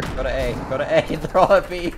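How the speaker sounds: shouting callout. Real, not acted. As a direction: shout the order across the team. Calling teammates mid-round.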